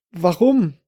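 fearful question. A narrator's reading. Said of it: "hob ängstlich die Hände".

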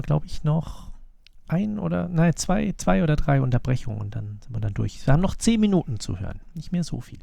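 usual speaking voice

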